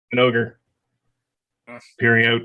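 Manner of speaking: normal talking voice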